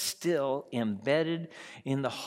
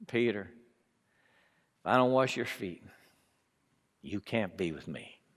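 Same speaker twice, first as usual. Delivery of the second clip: softer, lower tender reply; Jesus, gentle; 9 semitones lower, 8 dB quieter than usual. Acted. Jesus gently answering Peter's refusal, soft and low. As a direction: answer him gently and quietly.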